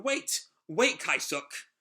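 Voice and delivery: urgent high call of wait. Acted. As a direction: call after him as he leaves. Yona runs out calling after him.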